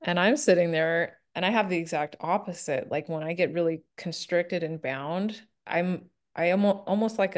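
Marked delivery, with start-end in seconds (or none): none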